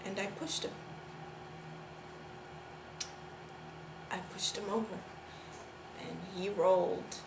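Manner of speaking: soft, low, halting admission; subdued, guilty near-murmur; hushed confession. Acted. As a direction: confess it quietly, with long pauses.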